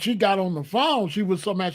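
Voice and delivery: ordinary talk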